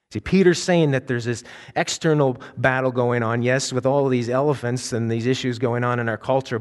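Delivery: ordinary talk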